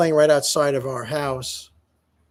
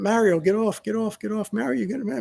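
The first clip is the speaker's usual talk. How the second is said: imitated urgent neighbors; 5 semitones higher than usual. Other fathers stopping a beating, imitated urgent calls. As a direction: lightly act out neighbors calling a man off.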